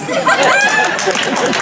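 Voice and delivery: high delighted cackle. Real, not acted. Comedian laughing at her own joke, cackle. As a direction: cackle at your own punchline.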